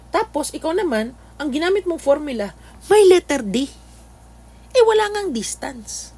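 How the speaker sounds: high-pitched, incredulous mock scolding; mock exasperation. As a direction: scold the student playfully, voice climbing high. Mock scolding of student's formula choice. (kunwaring naiinis na panenermon)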